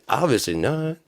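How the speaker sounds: ordinary talk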